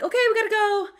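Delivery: high, loud hurried cheer hiding fear; forced calm panic. Retold or imitated. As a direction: rush the kid out pretending all is fine. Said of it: "I'm like".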